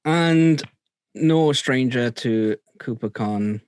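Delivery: ordinary delivery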